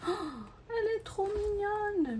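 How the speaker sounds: high squeal of delight over a cute canvas; a real burst of delight. Real, not acted. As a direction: gush over it in a sudden high pitch. Delighted reaction opening the canvas.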